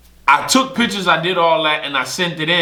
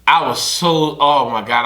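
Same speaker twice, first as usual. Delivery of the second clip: ordinary talk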